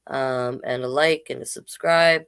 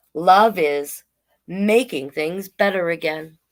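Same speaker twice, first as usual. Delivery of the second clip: slow, sing-song picture-book read; reading to children; 3 semitones higher, 3 dB louder, slower than usual. Acted. Drag queen reading a picture book to kids, slow and sing-song. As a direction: read each page slowly to the little ones.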